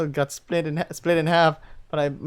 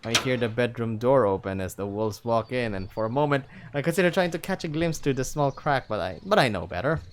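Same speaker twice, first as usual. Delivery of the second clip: ordinary delivery, nothing marked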